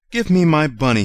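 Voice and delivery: put-on a small boy insisting on his toy; boy demanding. A narrator's reading. Boy to Nana about his rabbit, loud and high. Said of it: "he said".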